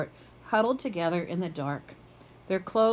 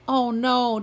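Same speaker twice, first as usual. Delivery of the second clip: howling; 4 semitones higher, 5 dB louder than usual. A narrator's reading. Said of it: "Dante howled".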